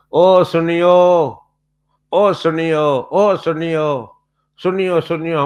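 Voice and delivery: slow, high, loud drawn-out jeer; taunting call. Retold or imitated. Preacher imitating the man taunting him, drawn-out jeer. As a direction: call out mockingly, stretching it. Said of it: "ایسے پکارتا تھا، تانا دیتا تھا".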